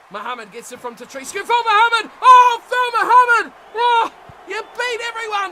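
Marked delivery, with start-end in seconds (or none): 1.3-5.5 high, loud, strained cheer; ecstatic yell. Real, not acted. Commentator screaming as Phil Muhammad beats everyone, ecstatic yell. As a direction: scream for the run as it happens.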